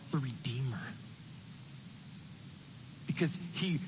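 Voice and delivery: hushed whisper; preacher naming Jesus reverently, whispered. Real, not acted. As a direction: breathe the word softly. Reverent climax of point.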